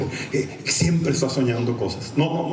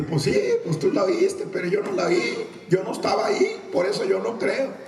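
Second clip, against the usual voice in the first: higher, dragging mock protest; sceptical objector; 8 semitones higher than usual. Doubter answering the apostle, high mock objection. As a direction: argue back in a raised voice.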